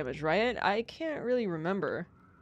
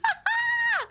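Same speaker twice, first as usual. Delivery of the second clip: high wailing laugh-cry. Pretend.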